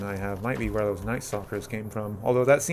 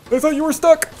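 high, loud shocked outburst; startled shout; 10 dB louder, faster than usual. Real, not acted. Player yells at an enemy that wasn't stuck after all. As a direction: yell it in sudden alarm.

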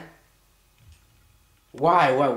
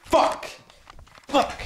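high barked curse; sharp curse; 10 semitones higher than usual. Real, not acted. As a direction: spit it out in frustration. Cursing at the video.

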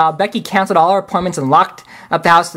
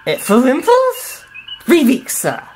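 slow, higher put-on Russian accent; 8 semitones higher, slower than usual. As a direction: Chekhov answering in a hammed-up Russian accent. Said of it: "instead of the Russian accent".

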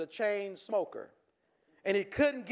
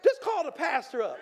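mock church member; 7 semitones higher, 4 dB louder than usual. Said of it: "And you say".

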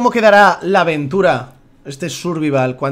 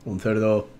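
deadpan low; 8 semitones lower, 7 dB quieter, slower than usual. Pretend. Deadpan repeat for laughs.

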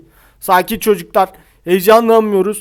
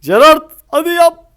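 urging; 8 semitones higher, 4 dB louder than usual. Real, not acted. Urging player to score.

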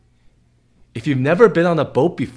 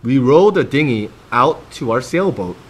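put-on reading to children. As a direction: read it like bedtime for little ones. Reads picture book to kids.

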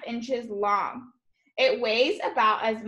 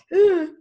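sharp loud high gasp; shocked gasp; 7 semitones higher, 9 dB louder than usual. Real, not acted. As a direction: gasp in alarm, loud and sudden. Reacting to poisonous spider.

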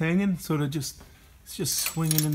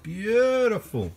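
admiring; 9 semitones higher, 4 dB louder, much slower than usual. Real, not acted. Admiring part dropping out cleanly.

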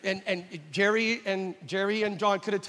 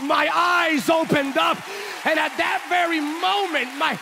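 high, loud and slow, joyful testimony; overjoyed shout; 8 semitones higher, 5 dB louder than usual. Retold or imitated. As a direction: shout like a man who just got his sight. Healed blind father retold.

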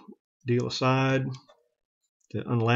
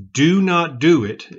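slow, emphatic do not do it, louder; firm warning; 4 semitones higher, 6 dB louder, slower than usual. Real, not acted. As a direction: lean hard on do not do it, each word weighted. Warning not to adjust bolt.

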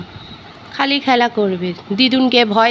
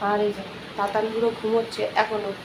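ordinary talk

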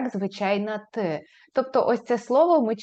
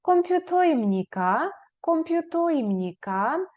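Комп'ютоємніка higher and rising at the end; question rise; 5 semitones higher, slower than usual. Shown as a demonstration. Teacher models the question with a rising tune. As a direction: ask it, pitch climbing. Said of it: "ви спитаєте".

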